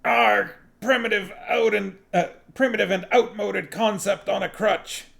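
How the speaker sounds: high, loud blaspheming yell; shouted oath. A narrator's reading. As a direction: cry it out as if swearing in pain. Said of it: "and shout".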